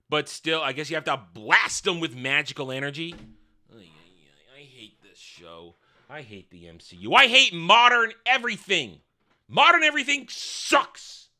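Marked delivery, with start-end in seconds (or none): 3.7-7.1 muttered despair. Real, not acted. Groaning at the show.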